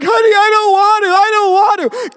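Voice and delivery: high, loud hammed-up wail of protest; mock crying wife. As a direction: whine and sob like a wife refusing to get back on. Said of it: "you're crying like".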